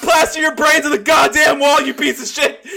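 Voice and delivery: high, loud shouted threat; sudden furious threat. Retold or imitated. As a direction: snap from friendly to murderous. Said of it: "he was very friendly".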